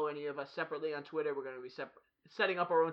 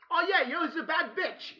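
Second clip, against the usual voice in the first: high, slow, laughing mock lover voice; mock Ricky Gervais; 10 semitones higher, slower than usual. Friend playing Ricky Gervais caught cheating, high laughing mock voice. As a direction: ham up a cheeky British lover through laughter.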